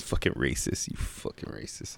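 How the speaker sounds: whispered fake outrage; mock whisper accusation. Teasing his friend over cherry blacks, in a whisper. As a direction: hiss the accusation as a joke.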